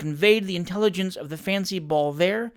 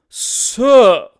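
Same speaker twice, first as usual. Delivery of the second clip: affronted; 6 semitones higher, 12 dB louder than usual. Acted. Tupman insulted.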